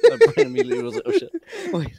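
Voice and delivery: shrieking with laughter; laughing hard. Real, not acted. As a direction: talk through a burst of laughter. Bursts out laughing mid-sentence.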